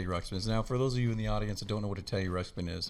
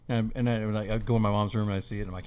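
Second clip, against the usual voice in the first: ordinary talk, nothing marked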